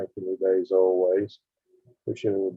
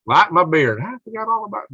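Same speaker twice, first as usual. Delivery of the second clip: excited; 10 semitones higher, 6 dB louder than usual. Real, not acted. Suddenly remembers the beard lights.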